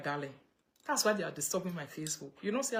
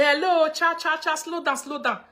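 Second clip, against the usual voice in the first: very high, loud mock pleading; overwhelmed listener; 10 semitones higher, 11 dB louder than usual. Acting out someone begging her German-speaking sister to slow down, mock alarm. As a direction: cry out high and loud, as if swamped by fast speech. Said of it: "you say".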